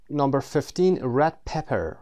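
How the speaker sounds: ordinary talk, nothing marked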